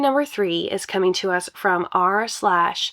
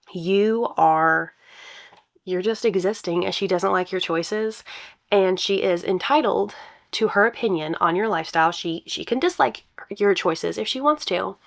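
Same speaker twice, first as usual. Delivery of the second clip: usual speaking voice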